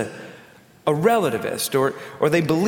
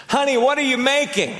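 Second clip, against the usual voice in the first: husband calling from fifteen feet, raised voice; calling out to wife; 10 semitones higher than usual. Retold or imitated. Husband calls to his wife in the kitchen, raised and higher. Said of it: "and he says".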